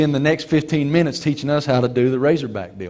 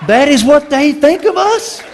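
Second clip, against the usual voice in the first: higher, louder, laughing through the question; laughing mock outrage; 12 semitones higher, 7 dB louder than usual. Coach scoffing at network's choice, laughing and loud. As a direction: play the coach's indignation for laughs.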